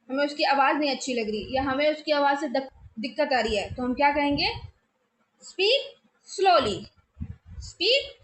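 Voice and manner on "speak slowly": stretched out, each word slow and clear; slow careful classroom line; slow demo. Shown as a demonstration. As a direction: say it slowly, like a patient teacher. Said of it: "धीरे बोलिए".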